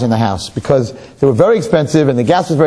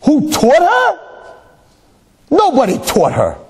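husband's reply; 13 semitones higher, slower than usual. Acted. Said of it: "and says".

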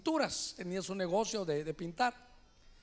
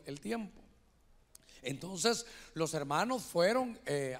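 normal talking voice

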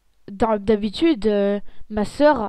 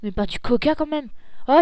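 ordinary talk

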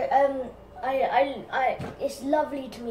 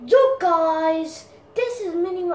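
loud, higher hyped-up YouTuber greeting; Mini Minter impression; 4 semitones higher, 8 dB louder than usual. Second try at the YouTuber intro, loud and hyped. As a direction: bark a hyped intro at the viewers. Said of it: "that's not how you say it".

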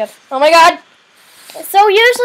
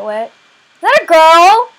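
usual speaking voice

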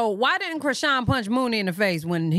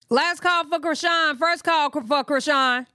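higher-pitched pretend last call; mock stage call; 6 semitones higher than usual. Listener mimics the call for Krishan, mock stage announcement.

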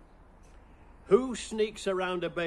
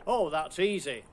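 ordinary talk, nothing marked

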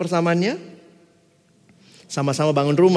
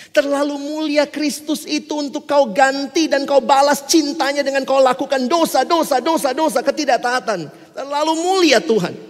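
higher, urgent preaching; earnest preaching; 8 semitones higher, 5 dB louder than usual. Acted. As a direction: plead with them. Preaching at full force.

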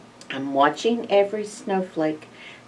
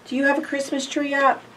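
ordinary delivery, nothing marked

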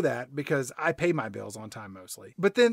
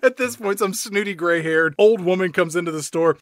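ordinary delivery

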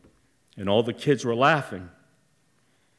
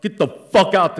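rising yell, louder and higher as it goes; retold drunken scream; 6 semitones higher, 6 dB louder than usual. Father screaming at mother in the car, angry yell. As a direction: yell at her like the furious father. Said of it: "My dad was screaming at my mother".

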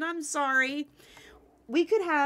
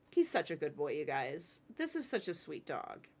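ordinary delivery

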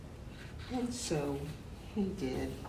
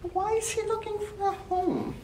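high curious voice; 13 semitones higher than usual. Acted. Teacher voicing curious question.

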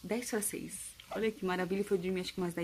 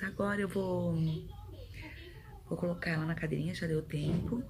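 ordinary talk

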